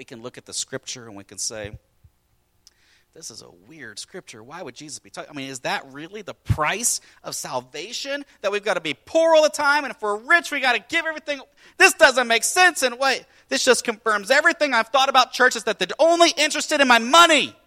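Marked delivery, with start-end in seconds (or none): 3.1-5.3 puzzled aside. Acted. Skeptic voicing puzzlement. 5.3-17.5 from puzzled to loud, high protest; building outrage. Acted. Skeptic grows outraged.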